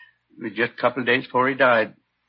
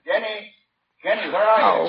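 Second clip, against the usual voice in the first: high, raised call from offstage, a little distant; calling from another room; 11 semitones higher than usual. Acted. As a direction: call out to her from the back room. Husband calls wife from another room.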